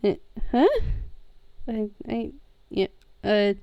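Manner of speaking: soft, stammering, broken little questions; flustered bewilderment. Acted. Sammy is recognised by the shopkeeper, flustered and stammering. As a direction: stammer quietly in confusion, with halting gaps.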